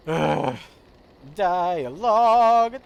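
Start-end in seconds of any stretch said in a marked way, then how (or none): 1.3-2.8 a high, very slow rising whine on the word; exasperated groan. Real, not acted. Streamer groaning at game dialogue, slow exasperated whine. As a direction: stretch it out like you cannot take it anymore.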